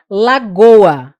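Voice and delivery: ordinary talk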